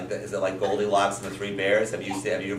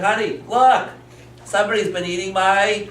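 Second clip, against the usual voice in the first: high, loud storybook indignation; father in story; 10 semitones higher, 5 dB louder than usual. Acted. Father shows his wife the eaten soup, hammed-up indignation. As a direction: act out an aggrieved father, pitched up. Father's complaint voiced for children.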